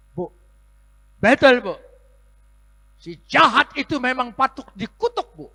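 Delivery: very high, loud indignant cry; mock fury. Pastor mirroring the woman's anger, very high and loud. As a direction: outdo her anger on purpose.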